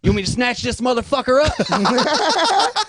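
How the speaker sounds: imitating a buddy's high, drawn-out holler; retold rowdy call-out. Buddy offering backup across Pizza Hut, mock rowdy holler. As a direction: call out high and slow, ready to step in.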